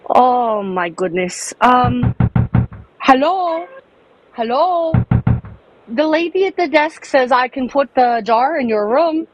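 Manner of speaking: ordinary talk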